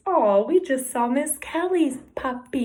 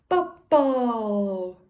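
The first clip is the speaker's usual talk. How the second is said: ordinary talk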